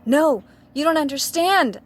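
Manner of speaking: high, loud pleading cry; sad scream. Acted. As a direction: scream desperately to be understood. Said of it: "screamed Draco sadly".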